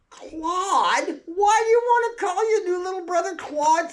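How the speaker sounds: very high, loud astonished question; amazed mother. Retold or imitated. Mother reacting to daughter's odd name, high astonished question. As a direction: squeal it in disbelief.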